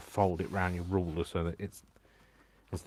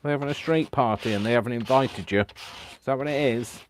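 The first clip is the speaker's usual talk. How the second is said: raised, loud, cooing sympathy; talking to a pet; 5 semitones higher, 8 dB louder than usual. Real, not acted. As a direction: commiserate as if with a pet. Sympathising with pet about noise.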